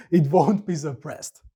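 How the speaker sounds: ordinary talk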